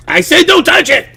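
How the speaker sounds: very high, loud panicked yell; frantic warning. Retold or imitated. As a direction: screech it as an acted last warning. Retold escalated warning to child.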